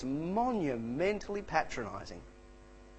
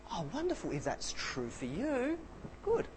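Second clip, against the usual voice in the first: mock encouraging, slow and soft; 6 semitones higher, 8 dB quieter than usual. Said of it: "a very encouraging person could go".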